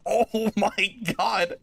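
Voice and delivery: high squealing disbelief with laughter; shrieking jackpot winner. Real, not acted. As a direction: squeal like you just hit the jackpot. Big loot drop, laughing disbelief.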